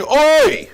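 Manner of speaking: sudden high loud wail of frustration; a sharp, pained oi; anguished cry. Retold or imitated. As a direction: cry out like a man cheated in court. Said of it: "somebody's gonna walk out of court going".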